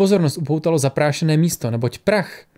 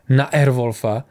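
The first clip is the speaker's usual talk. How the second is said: ordinary delivery, nothing marked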